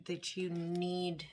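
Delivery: usual speaking voice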